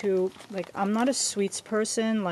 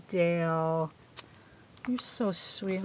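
ordinary talk, nothing marked

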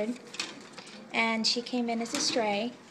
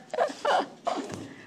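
airy, voiceless high laugh; wheezy giggle at the kitten; breathless laugh; 12 semitones higher than usual. Real, not acted. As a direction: laugh almost without voice. Kitten misbehaves on camera.

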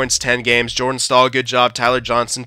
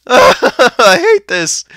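cracking voice; 11 semitones higher, 4 dB louder, much slower than usual. Real, not acted. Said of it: "My voice is cracking and everything".

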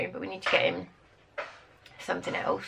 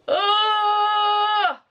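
long, high, loud "ahh"; loud drawn-out groan held high; exasperated wail; 13 semitones higher, 8 dB louder than usual. Real, not acted. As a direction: wail at the pile of jobs. Groans over loads of jobs.

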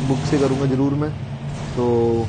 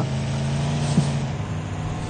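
quiet breathy laugh; 8 dB quieter than usual. Real, not acted. Laughs at own mistake.

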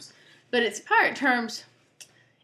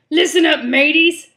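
loud gruff pirate bark, higher than usual; wrong voice for parrot; 7 semitones higher, 12 dB louder than usual. Acted. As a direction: call out to the crew as a pirate. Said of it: "Oh, wait, wrong voice".

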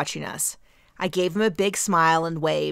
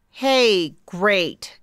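forced cheer; 3 semitones higher, 6 dB louder than usual. Acted. Hurried exit, forced cheer.

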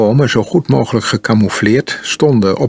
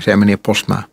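ordinary delivery

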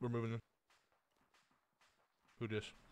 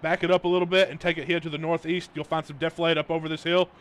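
shouting orders; 4 semitones higher, 7 dB louder than usual. Real, not acted. Shouting orders over game noise.

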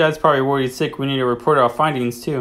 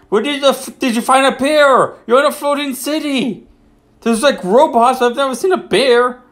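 much higher, disbelieving outburst; can't believe the bear story, voice jumps up; mock outrage at a bear tale; incredulous; 9 semitones higher than usual. Real, not acted.

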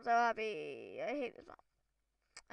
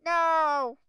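shout; 4 semitones higher, 11 dB louder than usual. Real, not acted. Fails the parkour jump.

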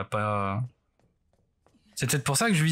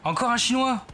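dismayed surprise; 11 semitones higher than usual. Real, not acted. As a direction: gasp at the new threat. Another tough zombie appears.

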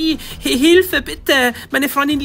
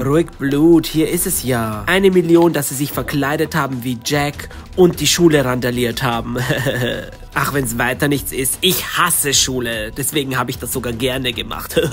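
lower, smug schemer gloating over the money; a lower-voiced rich brat boasting with a sneer; smug villain; 6 semitones lower than usual.

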